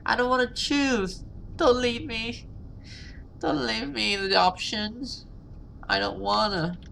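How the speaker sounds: high whining plea; high whimpering complaint; whiny distress. Real, not acted. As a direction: beg not to have to choose. Streamer distressed by choice.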